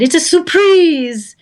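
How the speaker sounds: high, loud, slow put-on movie line; movie quote voice. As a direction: deliver it like a line from a film. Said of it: "Why did you say it that way?".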